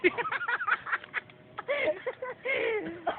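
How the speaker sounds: high-pitched peals of laughter; laughing fit. Real, not acted. Battler breaking up mid-routine, high laughter.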